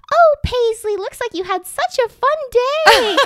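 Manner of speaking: high, loud sugary dog-owner voice; sweet lady voice. Retold or imitated. Dog owner coos to her returned dog in a sweet high voice. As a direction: coo it like a doting dog mom. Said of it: "the sweetest lady I've ever talked to".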